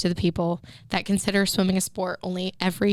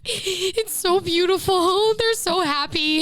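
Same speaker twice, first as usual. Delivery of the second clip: high, drawn-out mock weepy wail; imitated sobbing; 7 semitones higher, slower than usual. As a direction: whine it tearfully, overcome by happiness. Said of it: "sobbing at breakfast".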